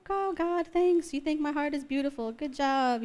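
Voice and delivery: high-pitched, awkward thanks; uncheesy thanks. Retold or imitated. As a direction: play down the compliment in a small high voice. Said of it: "cheesy person, so I'm like".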